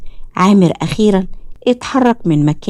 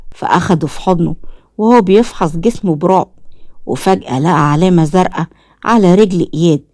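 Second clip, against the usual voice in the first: plain reading voice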